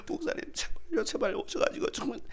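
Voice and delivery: high, strained begging; acted pleading. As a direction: beg the pastor urgently, pitch way up. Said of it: "막 저한테 애원하는 거예요". (목사님께 매달려 애원하는 흉내)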